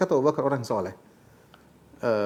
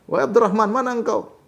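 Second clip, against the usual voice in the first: calling out high, louder on the name; retold shout; 8 semitones higher, 3 dB louder than usual. Abu Bakar calling his son again, high shouted call. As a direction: call again, higher, for the son who won't come. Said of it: "Abu Bakar berteriak".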